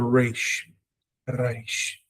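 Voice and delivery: "Reish" with vibrating tongue-tip R; rolled Reish. Shown as a demonstration. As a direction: trill the R of "Reish". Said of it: "There must be a vibration of the tongue".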